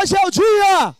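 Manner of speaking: high, loud, drawn-out slogan; rallying shout. Shown as a demonstration. Host leads the hall in a slogan, rallying shout. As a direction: shout it for a crowd to echo.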